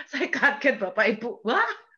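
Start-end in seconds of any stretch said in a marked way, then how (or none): 1.5-1.9 shocked wah. Retold or imitated. Shocked at God's rebuke.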